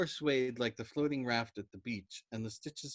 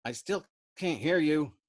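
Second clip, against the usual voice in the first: tolerant complaint; 5 semitones higher, 8 dB louder than usual. Acted. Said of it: "Arfie complained tolerantly".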